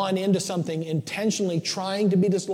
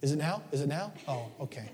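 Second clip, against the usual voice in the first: softer hopeful dog asking to go out; 8 dB quieter than usual. Retold or imitated. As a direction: lightly voice the dog waiting by the door. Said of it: "She looks at me".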